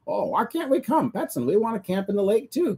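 a hint of clucking hens pleading; cackling hens. Hens beg Petson to come along, lightly voiced high cackle. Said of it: "cackled the hens".

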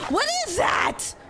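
high-pitched startled shout; alarmed cry. Real, not acted. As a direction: cry out in alarm at a game scare. Alarmed reaction to game scare.

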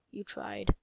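a deep, teasing "you tried"; low mock taunt. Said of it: "says it again much lower".

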